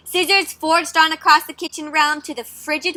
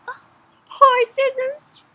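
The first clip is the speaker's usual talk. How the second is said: higher, slow sobbing pity; mock sad; 10 semitones higher, slower than usual. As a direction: wail over the losing Scissors. Pitying the loser.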